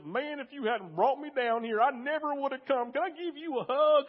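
put-on high, loud Kentucky accent. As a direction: imitate Jack's Kentucky accent, high and excited. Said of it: "that's your accent".

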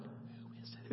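whispered, no voice; whispered question. Retold or imitated. Teenage son asking mom who called, whispered. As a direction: whisper it so the caller won't hear. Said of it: "I'm like".

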